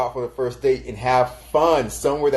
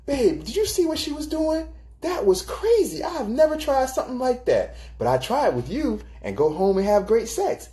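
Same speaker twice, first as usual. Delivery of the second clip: put-on much higher, giddy gossiping girlfriend; excited girlfriend; 10 semitones higher than usual. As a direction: squeal it out to your babe, amazed. Excited partner after the club.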